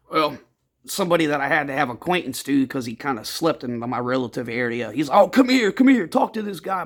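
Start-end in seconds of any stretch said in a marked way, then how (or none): 5.1-6.8 raised, higher retold summons; calling someone over. Man at the shelter urging him over to talk, calling out. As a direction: imitate an eager call-over, louder and higher.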